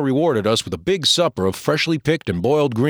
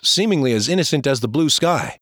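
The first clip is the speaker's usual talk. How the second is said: ordinary delivery, nothing marked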